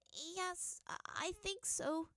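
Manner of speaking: high, slow hesitant stammer; girl stammering answer to father; scared stammer. A narrator's reading. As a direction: falter with fear.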